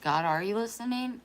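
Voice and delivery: a daughter voicing her mother's anguished prayer, loud and pained; desperate plea to God. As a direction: voice her mother's raw despair, pleading upward. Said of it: "And then you're like".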